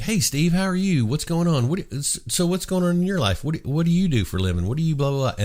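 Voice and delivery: high-pitched, eager friendly questioning; chatty dad. Retold or imitated. Dad meets son's friend Steve, chatty and high-pitched. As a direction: play dad peppering a visitor with interested questions. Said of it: "He'd be like".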